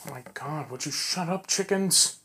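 rising exasperated grumble; fed up with the noise. Real, not acted. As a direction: start under your breath, build to an irritated plea. Irritated at noisy chickens while recording.

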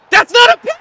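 high, loud shriek of disbelief; outraged yell. Real, not acted. Gamer shrieking at the screen over a penalty call, outraged yell.

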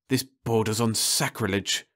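ordinary delivery

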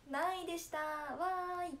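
high, drawn-out mock cheer; imagined cheer. Streamer imagining what they would have announced, mock cheering. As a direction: play a happy announcement, stretched out.

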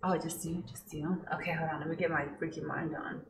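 low, very soft murmur; hushed. Real, not acted. Partner getting freaky mind on for dare, hushed. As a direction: keep it down low, almost under your breath.

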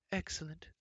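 a bustling, doting queen; fussy queen. A narrator's reading. Queen approves.

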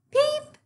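very high tiny peep; chick peep. Acted. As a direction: squeak it like a baby chick. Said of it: "chick, calf called".

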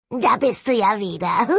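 high, gloating skull voice; clattering jaw cackling skull. A narrator's reading. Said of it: "kicherte der Schädel hämisch".

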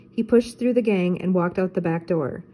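usual speaking voice

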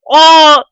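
loud startled yelp; screaming while falling. Real, not acted. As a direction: shriek as you drop. Falling, then "kızarıyorum.

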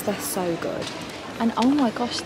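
ordinary delivery

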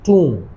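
"टूम" with long oo, no b sound; correct pronunciation. Shown as a demonstration. Teacher repeating tomb with silent b, correct pronunciation. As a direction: draw out the oo in "टूम". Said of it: "बी silent है".

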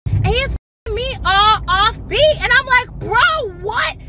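high, loud incredulous retelling; high-pitched appalled recap; exasperated disbelief. Retold or imitated. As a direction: wail it in outraged disbelief. Exasperated retelling of bad rhythm.

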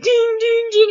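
high sung ding-ding of a grandfather clock; mock bell chime, high and ringing; imitated clock chime. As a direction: chime it out like a big old clock. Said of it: "it's like a grandfather clock".

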